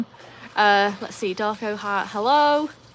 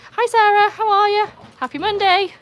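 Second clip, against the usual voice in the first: high, loud sing-song hello; excited greeting; 13 semitones higher, 7 dB louder than usual. Real, not acted. Streamer greets a viewer with a high, loud hello. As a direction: greet her like a long-lost friend.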